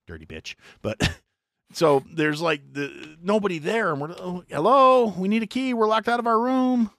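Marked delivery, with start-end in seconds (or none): 4.2-6.9 high, loud call into an empty lobby. Retold or imitated. Locked-out guests calling to the empty front desk, high and loud. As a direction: call for a clerk who isn't there.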